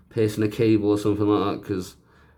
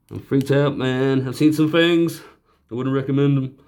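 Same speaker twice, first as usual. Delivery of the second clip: Family Guy quote; 5 semitones higher, 5 dB louder than usual. Retold or imitated. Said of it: "That is a funny joke on Family Guy".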